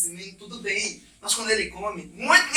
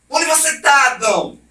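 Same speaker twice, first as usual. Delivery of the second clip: loud, higher furious call; mock angry God; 6 semitones higher, 6 dB louder than usual. What God did not sound like: a loud angry call to Adam. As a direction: bark it like an outraged judge. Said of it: "não aparece como um ser revoltado, com raiva".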